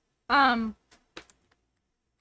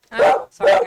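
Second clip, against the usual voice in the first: startled; 12 semitones higher, 11 dB louder than usual. Real, not acted. Dog barking, sudden apology.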